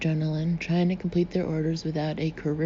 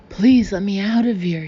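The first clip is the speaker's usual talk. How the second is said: wailing; 2 semitones higher, 8 dB louder than usual. A narrator's reading. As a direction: plead to be let out. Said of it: "he wailed".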